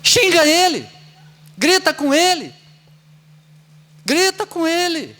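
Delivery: high, loud mock urging; mock egging on. Preacher mockingly urging the audience to yell at the driver, pitched high and loud. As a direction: egg the crowd to yell at him.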